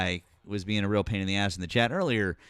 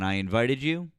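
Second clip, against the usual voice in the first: ordinary delivery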